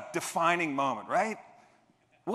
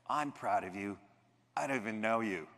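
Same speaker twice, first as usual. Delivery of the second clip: softly voiced officer, lightly lower; tender pride; 4 semitones lower, 5 dB quieter than usual. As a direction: say it like a kind giant.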